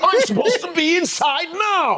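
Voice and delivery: very high, slow mock British indignation; haughty British toff. Refused guest demanding entry, mock haughty British. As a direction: ham up an indignant British aristocrat. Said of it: "Do you ever go".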